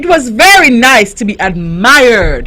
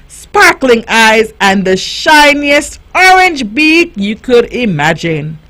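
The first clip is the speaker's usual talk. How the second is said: ordinary delivery, nothing marked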